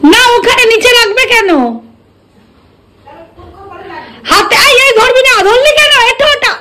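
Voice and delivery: high scolding. Real, not acted. Scolds the girl over the plate.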